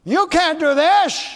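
slow, high, loud taunt; accusing lies. Acted. Lying spirit discouraging a believer, loud taunting voice. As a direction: drag it out loudly as a discouraging lie. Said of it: "when lies come to you".

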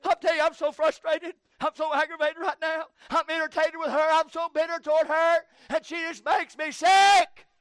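a hint of a fed-up husband venting, climbing to a high, loud rant; griping to sister. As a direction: whine it out, building to a shout. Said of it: "Call your sister".

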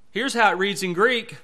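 ordinary talk, nothing marked

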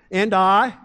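"I" hit loud and held for the crowd to answer; a loud cue word "I" for a call and response; stress on I. Shown as a demonstration. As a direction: punch "I" and pause so they can shout God. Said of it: "as soon as I say, "I," I want you to say "God".

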